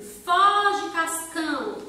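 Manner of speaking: high, loud call of "foge, cascão"; mock shout. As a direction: yell the comic balloon line like a warning. Reading comic speech balloon.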